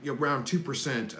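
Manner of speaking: usual speaking voice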